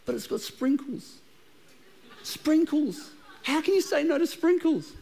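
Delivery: high, drawn-out mock-dreamy tempter voice; sprinkles temptation. Pastor's craving voice swooning over sprinkles, hammed-up. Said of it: "it's like".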